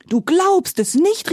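excited exclamation. A narrator's reading. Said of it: "rief Paddy".

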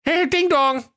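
very high chirpy shopkeeper; Dennis Höke's voice. Acted. Höke greets his first customer, high mock doorbell call. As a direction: imitate a doorbell in a high comic voice. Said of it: "sprach Dennis Höke".

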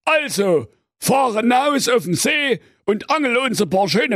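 comic grumpy fish king shouting at his son; bellowing king. Acted. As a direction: scold the lazy prince son at top volume. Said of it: "mit lieblicher Stimme".

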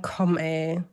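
ordinary delivery

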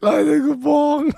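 cracking, croaky high greeting; voice giving out. Real, not acted. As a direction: greet them with a shredded voice. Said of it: "meine Stimme ist komplett weg".

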